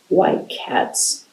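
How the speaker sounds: plain reading voice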